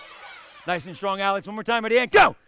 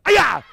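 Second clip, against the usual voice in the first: sharp, loud, higher kiai; kiai shout; 5 semitones higher, 9 dB louder than usual. Shown as a demonstration. Instructor calling a hard strike for the class: loud kiai. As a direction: let the kiai explode on impact. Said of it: "Hit it hard and kia".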